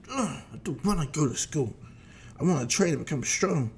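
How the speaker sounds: lightly voiced reluctant child; groan, then complain like a kid. Goten objects to school, complaining.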